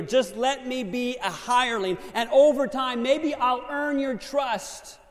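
high, loud, slow begging plea; pleading prodigal. Acted. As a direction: beg humbly to be a servant.

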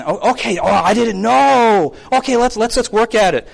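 mock-earnest realization; open, agreeable response. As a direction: take the correction readily, lightly acted. Acts accommodating American pastor's reply.

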